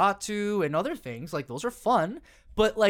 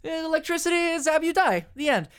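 high, far-off mock warning voice; cautious inner voice; 9 semitones higher than usual. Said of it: "My first instinct is".

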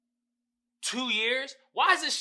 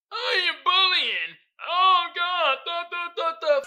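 slow, higher whiny mock voice; mocking TikTok; 9 semitones higher, 8 dB louder, slower than usual. Creator mocks TikTok for removing his clip, in a slow whiny voice. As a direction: dumb whiny parody of the app's notice.

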